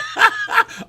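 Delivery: high breathy laugh; airy, high laughter. Real, not acted. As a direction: crack up. Cracking up at co-host.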